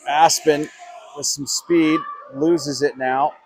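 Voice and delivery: ordinary delivery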